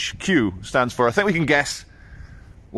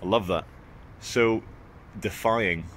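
normal talking voice

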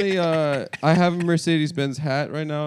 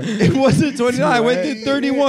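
indignant protest; 8 semitones higher, 5 dB louder than usual. Real, not acted.

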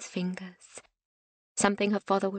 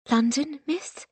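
higher, rising surprised question; startled question; 5 semitones higher, slower than usual. A narrator's reading. Young nurse hearing she is going to London, surprised. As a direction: ask it in timid astonishment.

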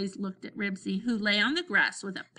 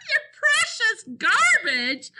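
high, loud, stretched-out howl; 13 semitones higher, 7 dB louder, slower than usual. A narrator's reading. Robert mocks Henry's precious garbage, howling. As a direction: howl it mockingly, slow and high. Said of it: "howled Robert".

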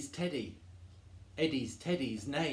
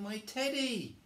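high cry at normal volume; cried out; 10 semitones higher than usual. Acted. Eddie cries out for his teddy, high. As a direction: exclaim it high, without shouting. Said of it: "cried Eddie".

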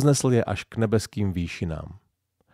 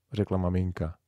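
plain reading voice

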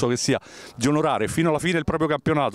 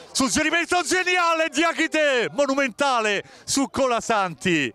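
excited commentary; 8 semitones higher than usual. Real, not acted. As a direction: shout the save with delight. Live save call.

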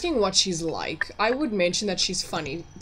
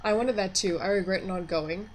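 ordinary talk

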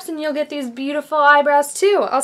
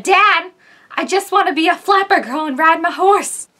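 higher, louder mock plea; pleading flapper girl; 4 semitones higher, 4 dB louder than usual. Flapper girl begs dad to let her ride, mock plea. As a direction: whine it at Dad like a thwarted daughter.

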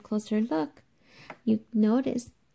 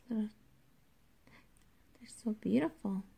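whisper; 7 dB quieter than usual. Acted. Whispered admiration of flowers.

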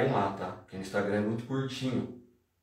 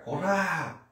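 aggressive cry; 5 semitones higher than usual. Shown as a demonstration. Teacher showing aggressive Japanese slang, high mock yell. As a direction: raise the pitch and stretch the final vowel. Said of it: "é muito agressivo".

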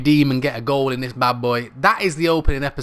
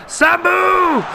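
high yell of a player's name; real excited cry; excited shout; 7 dB louder than usual. Real, not acted. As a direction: shout him on. Chance on goal.

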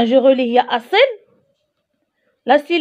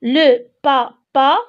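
slow repetition; much slower than usual. Shown as a demonstration. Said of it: "Je répète".

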